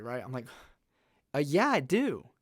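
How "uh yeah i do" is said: retold exasperated retort, swinging pitch; mildly frustrated insistence. Student insisting to his doubting teacher, frustrated. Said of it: "And I'm getting frustrated".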